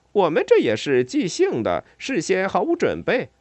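high, lively explanation; explaining. A narrator's reading. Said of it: "莱特解释道".

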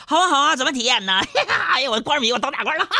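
high, fast, thrilled squeals of joy; 激动的媳妇. Retold or imitated. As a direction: gush like an excited wife. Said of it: "更激动地说". (媳妇激动得尖声嚷着要当大官)

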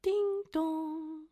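high sing-song ding dong; doorbell imitation. A narrator's reading. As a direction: chime it like a doorbell.

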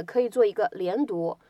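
usual speaking voice

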